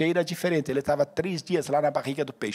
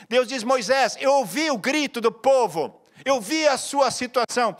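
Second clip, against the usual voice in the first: fervent preaching; 6 semitones higher than usual. Acted. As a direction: drive it home to the congregation. Preaching at full force.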